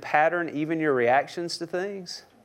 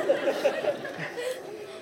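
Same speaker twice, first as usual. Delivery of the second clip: high giggle; 6 dB quieter than usual. Real, not acted. Laughing at own joke.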